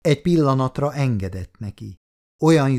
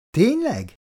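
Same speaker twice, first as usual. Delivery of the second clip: high-pitched, curious "really?"; intrigued, pitched well up; surprised question; 10 semitones higher than usual. A narrator's reading. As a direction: ask it with a big lift in pitch. Woman reacts to his boast.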